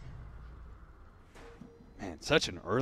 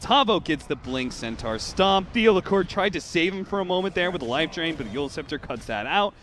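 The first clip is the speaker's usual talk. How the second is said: raised fight-caster voice easing off; excited play-by-play; 6 semitones higher, 7 dB louder than usual. Real, not acted. Caster following the fight as it winds down, excited play-by-play. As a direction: keep calling the fight with energy.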